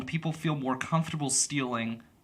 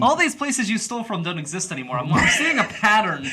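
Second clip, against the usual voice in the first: laughing; 7 semitones higher, 4 dB louder than usual. Real, not acted. Teasing a friend about stolen stores.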